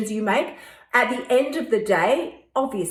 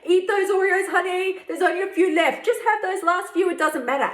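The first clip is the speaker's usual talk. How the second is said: higher, sweet-talking tempter; coaxing inner voice; 8 semitones higher than usual. Retold or imitated. Host voicing the inner voice tempting her with Oreos, higher and coaxing. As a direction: coax like a conniving little voice. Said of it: "voice that will connive its way around".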